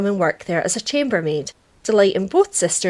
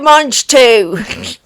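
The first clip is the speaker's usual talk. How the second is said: mock French, louder, leaning on mange; a Del Boy again, more punch; Del Boy impression; 5 dB louder than usual. Host repeats the Del Boy line, louder mock French. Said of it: "Little Del Boy reference".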